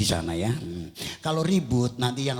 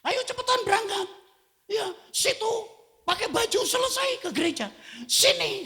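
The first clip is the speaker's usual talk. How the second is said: hammed-up impatient spouses; bickering couple. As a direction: spouses hurrying each other to church. Couple bickering before church.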